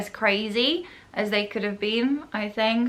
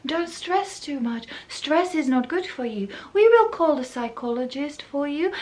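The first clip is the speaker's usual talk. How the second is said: patronizing calming staff; 5 semitones higher than usual. Retold or imitated. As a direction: soothe like staff dismissing a patient. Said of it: "everybody would be like".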